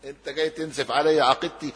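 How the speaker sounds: a high laughing no, no, no; laughing refusal. Retold or imitated. The Japanese man laughs off the meaning, high and laughing. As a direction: laugh off the idea as absurd. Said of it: "وقال".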